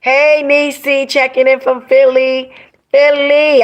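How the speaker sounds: high, loud hail; excited shout-out. Real, not acted. Streamer greeting a viewer from Philly, excited.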